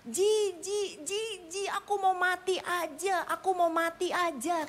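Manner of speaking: high, drawn-out mock wailing; retold distraught yelling. Husband wanting to die in front of his wife, retold wailing. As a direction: cry it out in acted despair, pitch up. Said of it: "Suaminya teriak-teriak".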